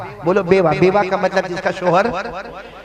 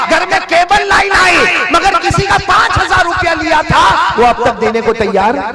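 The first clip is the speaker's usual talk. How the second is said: higher, louder rebuke building up; fiery preaching; 10 semitones higher, 6 dB louder than usual. Acted. Preacher rebuking people who never repay loans, loud and high. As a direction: scold the congregation with rising heat.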